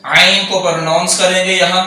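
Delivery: ordinary talk, nothing marked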